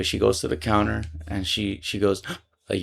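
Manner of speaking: ordinary talk, nothing marked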